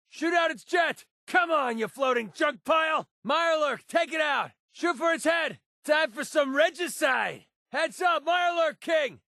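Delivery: high, urgent combat yells; shouted call-outs as monsters attack; battle shouting. Acted. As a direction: yell orders in a firefight.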